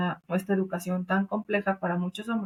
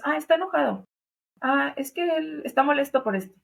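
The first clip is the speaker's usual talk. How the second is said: higher, lightly voiced quote of someone shrugging it off; mock excusing voice; 6 semitones higher than usual. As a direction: say it higher, as if brushing the anger aside. Excusing an angry man, quoted.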